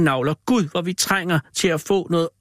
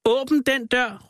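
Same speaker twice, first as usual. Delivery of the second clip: much higher and very slow, each word pulled; shouted order; 10 semitones higher, much slower than usual. A narrator's reading. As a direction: demand the door be opened. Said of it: "råbte George Hadley".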